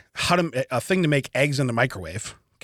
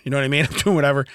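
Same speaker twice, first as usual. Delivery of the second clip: ordinary delivery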